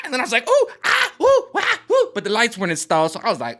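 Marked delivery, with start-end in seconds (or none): 0.5-2.1 very high, loud mock monkey hoots; squealing ooh ah in fake fright; mock panic noises. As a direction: hoot in hammed-up panic. Acted panicked noises, raccoon.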